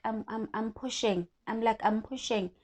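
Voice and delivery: ordinary talk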